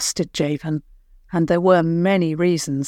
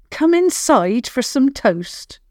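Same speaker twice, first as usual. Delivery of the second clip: usual speaking voice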